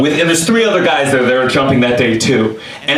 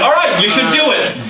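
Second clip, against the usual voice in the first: higher-pitched imitated friend; exasperated agreement; 9 semitones higher than usual. Friend now telling him to jump, exasperated. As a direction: relent with raised, emphatic pitch.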